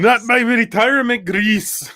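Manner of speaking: put-on high, drawn-out Scottish accent; slow comic Scottish outcry; Groundskeeper Willie. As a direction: wail it in a hammed-up Scottish burr. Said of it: "we do a bunch of groundskeeper Willie impersonations".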